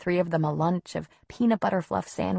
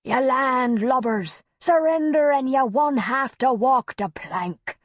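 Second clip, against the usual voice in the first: high, loud, hammed-up Cockney pirate call; a bad Cockney accent, yelled like a pantomime pirate; 7 semitones higher, 5 dB louder than usual. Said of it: "calling out with a bad Cockney accent".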